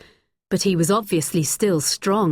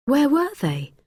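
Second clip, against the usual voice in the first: usual speaking voice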